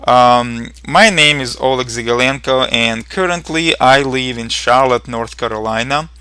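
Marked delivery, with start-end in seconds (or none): none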